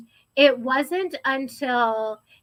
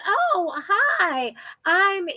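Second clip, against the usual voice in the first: higher, sugary sudden switch to polite; fake sweet mom; 7 semitones higher, much slower than usual. Mom greets the boyfriend in a higher, falsely sweet voice. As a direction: mom turning sweet for the guest.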